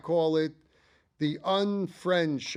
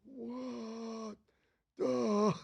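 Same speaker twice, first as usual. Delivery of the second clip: Iggy voice; 7 semitones higher, 6 dB quieter than usual. Retold or imitated. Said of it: "And he goes".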